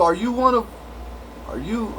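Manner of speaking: ordinary talk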